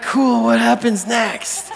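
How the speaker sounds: high, drawn-out question; eager friends. Acted. Imagined friends eager for more.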